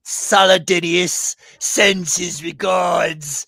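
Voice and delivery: slow, high, shouted last words; fanatic's defiant shout. Acted. Captive to the captains before beheading himself, slow high shout. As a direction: roar your final defiance as the fanatic. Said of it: "and he says".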